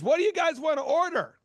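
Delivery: high-pitched call for orders; bartender calling out. Retold or imitated. Bartender asking packed crowd for orders, calling out.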